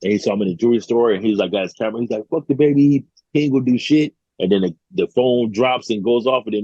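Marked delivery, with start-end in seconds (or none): 2.3-4.1 higher-pitched cocky retold jab; mock taunt. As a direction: imitate someone bragging on camera. Retold taunt filmed on camera.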